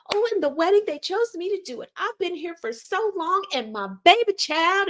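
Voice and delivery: high, sing-song mock of her bragging. Commentator mocking the officiant's excuses, high sing-song mimicry.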